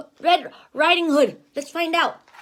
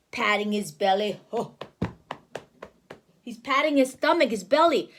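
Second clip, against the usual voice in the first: ordinary delivery, nothing marked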